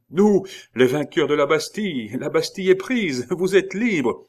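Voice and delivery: high, loud, jubilant proclamation; triumphant shout. A narrator's reading. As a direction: proclaim victory to the prisoner. Rescuers proclaim the prisoner free. (proclamation criée, triomphante)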